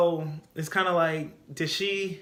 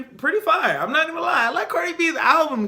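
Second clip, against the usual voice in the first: excited praise; 11 semitones higher, 6 dB louder than usual. Real, not acted. Gushing praise for album.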